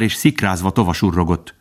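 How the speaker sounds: ordinary delivery, nothing marked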